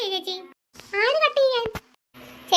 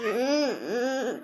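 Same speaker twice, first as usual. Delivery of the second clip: frightened whimper; 10 semitones lower, much slower than usual. Acted. Fleeing in fright.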